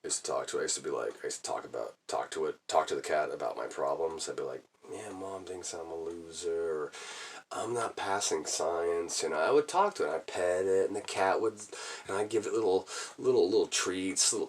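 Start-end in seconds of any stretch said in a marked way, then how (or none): 4.9-9.2 low, soft, dejected murmur; quiet confiding. Retold or imitated. Kid telling the cat his problems, quietly confiding. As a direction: confess troubles under the breath.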